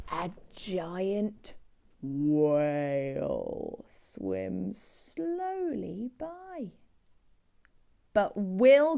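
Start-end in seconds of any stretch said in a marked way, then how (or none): 0.1-6.7 very slow, low whale voice. Acted. Telling children how the giant whale swims, slow.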